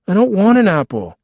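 put-on high, loud childish refusal; whining child. Boy rejecting brother's apple, whining.

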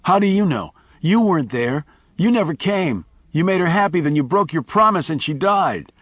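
put-on high, upset boy's outburst; child's accusation. A narrator's reading. Miles as a boy accusing Charlie, high and upset. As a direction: pour out the hurt like a child.

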